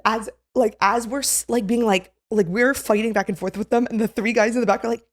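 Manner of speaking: usual speaking voice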